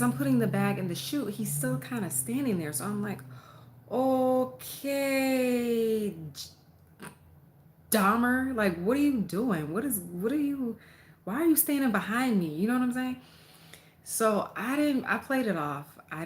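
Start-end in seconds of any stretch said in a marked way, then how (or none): 3.9-12.5 raised, higher, louder challenge to a creepy neighbor; exasperated loud demand; retold confronting yell. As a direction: call him out, loud and high. Confronting neighbor standing behind her.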